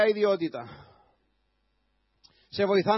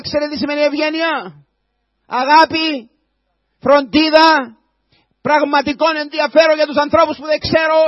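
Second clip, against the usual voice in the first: loud, higher preaching with hammered words; fiery preacher raising the voice; full-force preaching; 4 semitones higher, 7 dB louder than usual. Acted. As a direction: drive it home from the pulpit.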